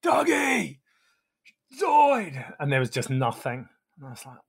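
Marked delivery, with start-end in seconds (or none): 0.0-2.5 high yell of two friends' names. Retold or imitated. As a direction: shout for friends from off piste. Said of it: "So I yelled out".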